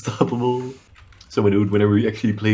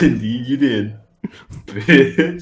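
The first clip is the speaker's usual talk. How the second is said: very slow drawn-out confirmation; mock grave drawl; smug slow agreement; much slower than usual. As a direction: stretch each word out smugly.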